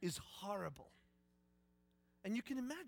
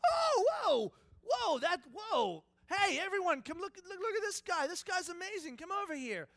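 high, loud, awestruck shouting; hammed-up crowd excitement; amazed onlooker; 11 semitones higher, 10 dB louder than usual. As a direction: call everyone over to see a marvel. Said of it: "Everyone's like".

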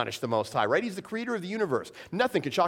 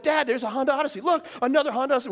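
put-on higher, eager kid voice pointing out cars; excited kids; 9 semitones higher, faster than usual. Kids in the car, calling out to dad, excitedly. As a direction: play the kids spotting the van. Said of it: "My kids are like".

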